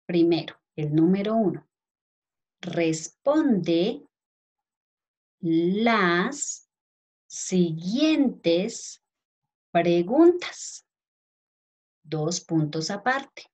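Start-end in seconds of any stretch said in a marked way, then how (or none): 2.6-10.9 each word spaced out and said slowly; slow dictation. Shown as a demonstration. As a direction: dictate it word by word for young pupils. Dictating heading to children.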